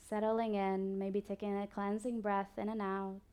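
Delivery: soft, slow body-scan guidance with long pauses; guided meditation. Acted. Yoga teacher guiding listeners through a body scan, calm meditation voice. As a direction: ease the listener into stillness, unhurried.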